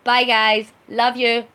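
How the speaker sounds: normal talking voice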